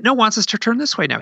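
high-pitched, urgent insisting; insistent wife. Retold or imitated. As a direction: imitate a wife excitedly correcting her husband. Wife giving directions to husband.

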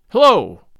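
Little John calls out loudly, high and bold; loud hail. A narrator's reading. Little John challenging the tanner, loud hail. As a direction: bark a bold challenge into the thicket. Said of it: "quoth he".

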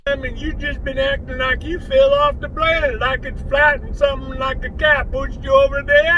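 a hillbilly voice drifting Jamaican at the end; slipping into Jamaican. Acted. Bubba scolds the doctor, his hillbilly voice slipping Jamaican. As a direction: let the Jamaican accent creep in. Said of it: "it became Jamaican at the end".

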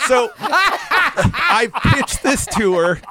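laughing hard at the memory. Real, not acted. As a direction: laugh it out as you tell it.

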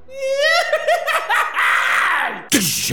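long, high screeching cackle; creature cackle. Acted. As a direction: swoop down with a shrieking cackle. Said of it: "all of you hear".

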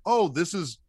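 ordinary delivery

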